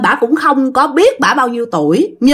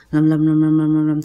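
low, monotone incantation murmur; imitated chant mumbling; 6 semitones lower, 3 dB quieter than usual. Master reciting over the possessed man, low monotone mumble. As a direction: drone a spell on one low note. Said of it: "vừa để vừa đọc".

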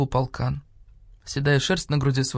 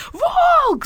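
very high, loud scream; piercing cry of alarm; terrified shriek; 6 dB louder than usual. A narrator's reading. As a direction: scream it at the sight of a wolf. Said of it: "завопила Нина Павловна".

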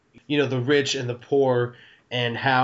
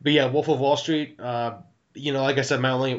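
ordinary talk

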